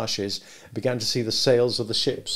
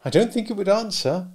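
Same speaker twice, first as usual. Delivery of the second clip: put-on higher young woman, gently doubtful; Biddy warning Pip off being a gentleman, higher voice; Biddy's voice; 10 semitones higher, 4 dB louder than usual. A narrator's reading. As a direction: voice Biddy a good deal higher, kindly advising.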